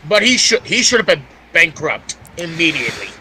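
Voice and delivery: ordinary delivery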